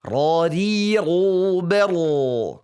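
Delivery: "Radiru" stretched out slowly and loud; slow ro drill. Shown as a demonstration. Teacher drills ro for students, slow and stretched.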